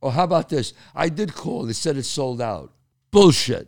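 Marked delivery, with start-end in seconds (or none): 3.1-3.7 loud, higher scoff at the excuse; mock outburst. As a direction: bark it out in disbelief.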